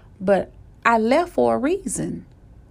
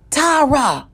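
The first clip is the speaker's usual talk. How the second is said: loud, higher-pitched call of a name; raised voice, demanding attention; insistent shout; 6 semitones higher, 5 dB louder than usual. Retold or imitated. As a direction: call out sharply to break through. Same call repeated, now shouted.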